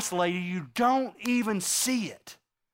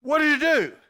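accusing shout; 6 semitones higher, 5 dB louder than usual. Retold or imitated. Accusing cry at God.

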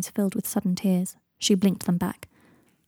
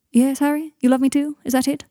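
higher, louder pointed questions; sharp challenge; 5 semitones higher, 5 dB louder than usual. Acted. Draco, stopped from leaving, confronts Harry, sharply challenging. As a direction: challenge him, daring an answer.

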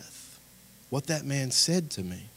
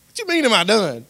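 high, loud mock outrage; mad; 10 semitones higher, 12 dB louder than usual. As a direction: fire back like an offended teen. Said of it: "I was mad at him".

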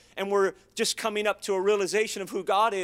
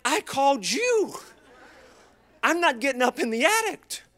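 indignant retort; 6 semitones higher, 4 dB louder than usual. Retold or imitated. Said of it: "I'm like".

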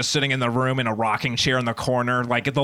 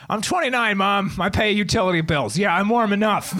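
high-pitched indignant retort; exasperated son; 8 semitones higher than usual. Acted. Twenty-nine-year-old to his texting mom, exasperated. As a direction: protest like a nagged grown child.

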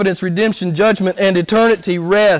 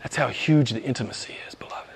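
low, whispered tenderness to the congregation; awed hush; 8 semitones lower, 10 dB quieter than usual. Real, not acted. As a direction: drop to a whisper in wonder. Awed aside to congregation after climax.

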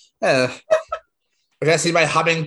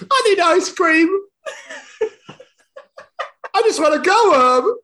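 laugh-crying; 14 semitones higher, 3 dB louder than usual. Real, not acted. Fake-weepy plea after the story.

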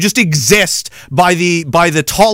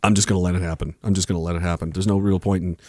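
low, soft, defeated monotone; subdued, low surrender; resigned; 11 semitones lower, 9 dB quieter, faster than usual. Real, not acted. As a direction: give up quietly, voice dropped right down. Giving up on resisting the Bs.

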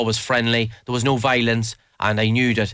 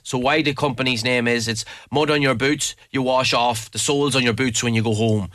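ordinary talk, nothing marked

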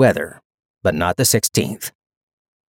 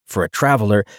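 plain reading voice

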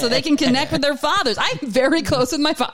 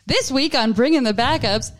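ordinary talk

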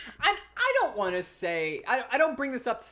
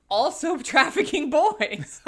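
laughing; 5 semitones higher, 6 dB louder, slower than usual. Real, not acted. Laughing joke.

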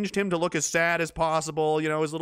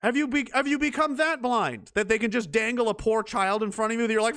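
ordinary delivery

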